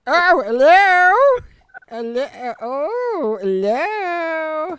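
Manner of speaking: put-on high quavering old lady calling; old dear voice. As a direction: call out like a deaf old woman. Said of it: "the old dear with the hearing problems".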